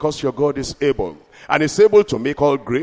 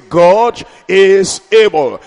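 slow, loud, stretched proclamation; drawn-out preaching; 4 semitones higher, 8 dB louder, slower than usual. Acted. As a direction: lean on every word for the crowd. Preaching refrain.

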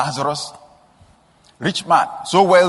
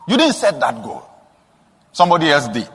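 ordinary talk

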